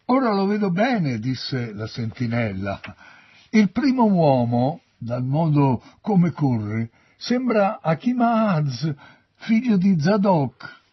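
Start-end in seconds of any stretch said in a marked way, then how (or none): 0.1-1.2 high, loud lookout's call. A narrator's reading. Sentinel reports to the king from the walls, calling out. 3.5-10.8 high, loud sentinel's report; calling out. A narrator's reading. Sentinel names the runner to the king, calling out.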